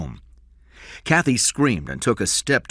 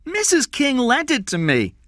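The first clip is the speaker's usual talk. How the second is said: put-on very high, loud child's voice; protesting boy; 7 dB louder than usual. A narrator's reading. Jeff explains who lent the sweater, protesting. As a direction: plead your case in a boyish pitch.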